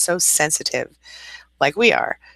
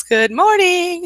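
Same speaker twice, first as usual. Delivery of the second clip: sing-song greeting; 13 semitones higher, 4 dB louder, much slower than usual. Retold or imitated. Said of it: "she says".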